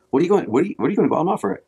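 put-on curious youth group kid. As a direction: play the excited kid asking. Youth group kid questions him.

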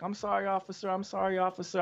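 a lightly voiced wife begging the officer; apologetic wife. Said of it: "she was like".

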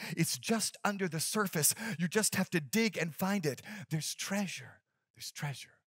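put-on soft, faint, trembling old father; a dying man's whisper-like last words; weak trembling voice. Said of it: "in a weak, trembling voice".

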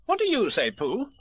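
high, bossy little rabbit voice; fussy Rabbit. Acted. Rabbit pressing Piglet to agree, bossy and high. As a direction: lay out the plan like a busy organiser.

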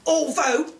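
ordinary delivery, nothing marked

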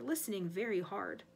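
plain reading voice

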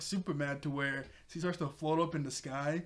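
ordinary talk, nothing marked

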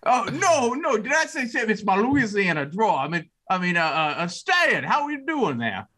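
Adam Sandler voice. Acted. [name] covers her slip to Stan in a goofy Sandler voice. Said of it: "Adam Sandler voice?".

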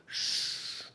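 soft breathy shush for silence; hushing shh. Acted. Marie hushing the room as they slip away, soft shush. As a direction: hush them like sneaking off. Hushing the audience, leaving invisibly.

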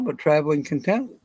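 ordinary delivery

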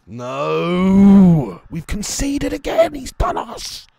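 long high, very loud wail of dismay; real despairing yell; anguished shout. Real, not acted. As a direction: cry out at conceding, voice shooting way up. Concedes another goal in the game.

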